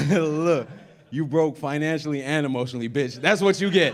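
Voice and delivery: loud laughing taunt; gloating laugh. Retold or imitated. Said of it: "I was all".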